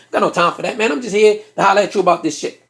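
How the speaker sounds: ordinary talk, nothing marked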